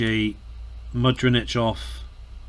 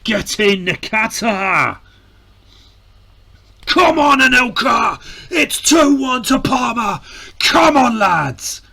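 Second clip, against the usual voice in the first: much higher, louder ecstatic shouting; goal celebration; 11 semitones higher, 5 dB louder than usual. Real, not acted. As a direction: roar with joy at the goal.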